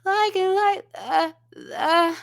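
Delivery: creaky fry on the notes; vocal fry. Retold or imitated. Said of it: "she put a little bit of vocal fry".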